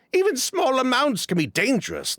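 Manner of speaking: ordinary delivery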